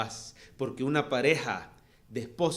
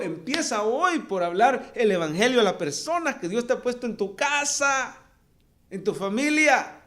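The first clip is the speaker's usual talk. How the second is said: raised, higher urging sermon voice; fervent preaching; 7 semitones higher, 4 dB louder than usual. Acted. Preacher urging the church to evangelize, fervent and raised. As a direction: push the call to mission hard.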